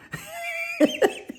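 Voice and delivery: very high, wheezy-light real laugh; high giggle. Real, not acted. Storyteller amused by his own punchline, high giggle.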